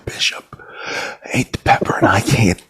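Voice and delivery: pained breathy whisper. Real, not acted. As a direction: whisper it, barely able to speak. Pained from hot pepper.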